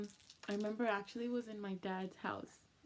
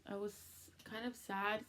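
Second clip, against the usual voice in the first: ordinary talk, nothing marked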